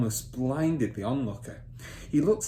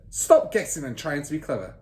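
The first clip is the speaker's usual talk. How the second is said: lightly loud rebuke; a hint of an angry father; scolding father; 5 semitones higher, 6 dB louder than usual. Father scolding Matilda for guessing, mildly angry.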